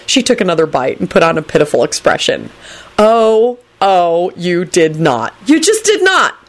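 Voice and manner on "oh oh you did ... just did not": disbelief jumping to high pitch; gasped-out indignation rising at the end; outraged disbelief. Acted. Neville outraged by Rosie's pity act, rising disbelief.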